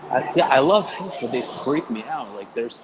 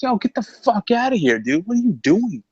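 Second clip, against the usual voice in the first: much higher, louder mock yell; retold shout at kid; 12 semitones higher, 7 dB louder than usual. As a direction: yell at the kid hitting you with a stop sign, half-joking. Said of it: "I was like".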